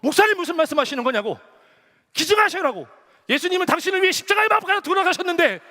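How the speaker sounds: high, loud indignant rebuke; angry outburst. Retold or imitated. Elder scolding the pastor for wanting out, angry outburst. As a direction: blow up at him, acting out an outraged elder. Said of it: "장로님이 막 보럭 막 하면서".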